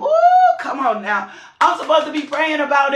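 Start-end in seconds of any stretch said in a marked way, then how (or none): 0.0-0.5 high, loud whoop of excitement; joyful woo. Real, not acted. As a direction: let out a big preacher's whoop. Preacher's exclamation in full flow.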